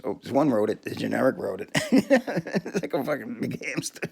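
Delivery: normal talking voice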